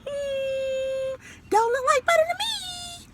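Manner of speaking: a skeptical raccoon sizing him up; doubtful raccoon. A narrator's reading. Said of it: "said the raccoon".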